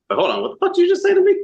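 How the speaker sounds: high, loud, rapid outburst; mock outraged disbelief. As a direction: act stunned by what your desk partner just said. Acted outburst at desk partner.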